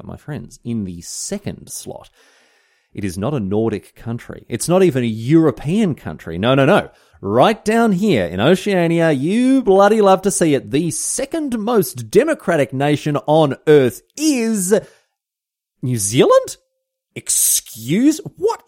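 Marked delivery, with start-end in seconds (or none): 4.5-14.7 mock excitement. Mock-patriotic build to reveal. 15.8-18.6 mock disbelief. Feigned disbelief at ranking.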